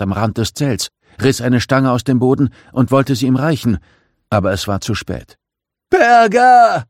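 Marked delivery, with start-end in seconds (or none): 5.9-6.8 loud, very high drawn-out scream; desperate bellow. A narrator's reading. Man being pulled into the abyss, desperate bellow. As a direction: scream the name out as a last plea. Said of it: "brüllte er verzweifelt".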